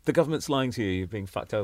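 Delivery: comedy line in a classy British accent; relaxed gentleman's British delivery. Shown as a demonstration. Comic samples his act for hosts, British accent. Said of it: "if I did my comedy like this".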